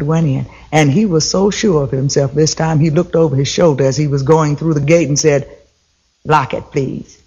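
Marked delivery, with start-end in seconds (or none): 6.3-7.2 smug Brer Rabbit. A narrator's reading. Brer Rabbit to Little Girl Bear as he enters, low smug aside. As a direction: toss it off over your shoulder, smug.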